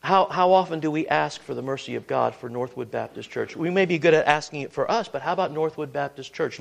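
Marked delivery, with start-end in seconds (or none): none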